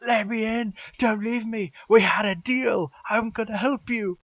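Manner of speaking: high, loud desperate pleading; frantic high-pitched yelling; screaming. Acted. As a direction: scream through a shut gate to be let in. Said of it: "banging on it".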